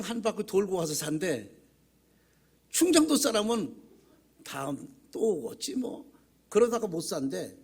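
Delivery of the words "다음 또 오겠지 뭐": soft, slow, unhurried mock Chungcheong drawl; lightly voiced easygoing country shopper, quiet and slow. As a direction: lazily put it off like a relaxed shopper. Imitating a laid-back Chungcheong shopper.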